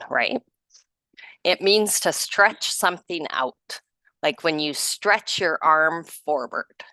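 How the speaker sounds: ordinary delivery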